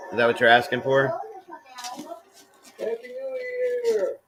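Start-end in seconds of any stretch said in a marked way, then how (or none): 2.8-4.2 squeaky high voice. Acted.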